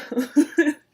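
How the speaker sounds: a sudden loud laugh breaking through the reading; burst of laughter. Real, not acted. Narrator laughs at own aside.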